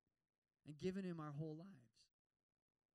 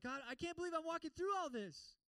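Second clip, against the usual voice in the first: high, loud whining; hammed-up complaint to God; mock whiny complaint; 10 semitones higher, 6 dB louder than usual. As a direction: whine at God like a put-upon kid.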